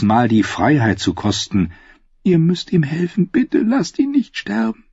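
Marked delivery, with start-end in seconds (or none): 2.2-4.8 put-on high, pleading young servant; pleading girl. A narrator's reading. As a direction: beg her not to let him die. (flehendes junges Mädchen)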